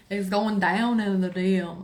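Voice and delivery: jokey drawl on "down"; joking put-on voice. Streamer joking about private messages, playful put-on voice. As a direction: stretch it out as a silly catchphrase. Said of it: "I'm just kidding".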